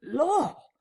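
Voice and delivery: high startled yelp; startled cry. Acted. Tramp Marvel gripped by an unseen hand, startled cry. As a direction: jump out of your skin. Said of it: "how you made me jump".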